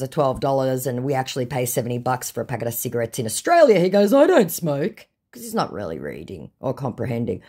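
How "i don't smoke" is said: louder, higher put-on protest; mock the scammer protesting; acted-out indignant reply.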